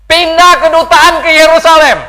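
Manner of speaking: high, shouted proclamation; Donald Trump. Acted. Preacher quotes the president's embassy announcement, loud proclamation. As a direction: bellow it out like an order from a podium. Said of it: "Ya, dia bilang apa?".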